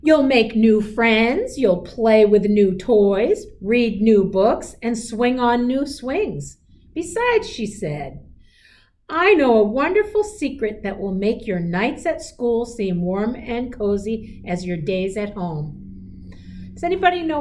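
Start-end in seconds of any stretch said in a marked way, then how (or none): 0.0-6.6 slow, louder, soothing mom voice; lightly voiced mother reassuring her child. Mother comforting worried child. 6.9-15.8 gentle storybook read-aloud; a teacher reading a picture book aloud; reading to children. A narrator's reading.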